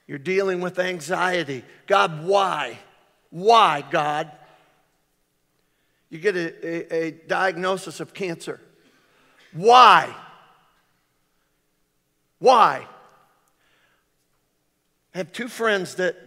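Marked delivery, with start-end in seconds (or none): 1.9-4.3 loud pleading cry to God; anguished cry. Retold or imitated. 9.5-12.9 loud, drawn-out cry of why; anguished wail. Retold or imitated. Cancer diagnosis anguish.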